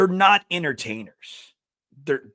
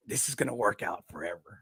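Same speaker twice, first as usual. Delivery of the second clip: low, soft retold reply; hushed certainty; 7 semitones lower, 9 dB quieter than usual. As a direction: say it low, as a quiet verdict. Mock-solemn retold reply to wife.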